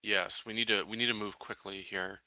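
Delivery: normal talking voice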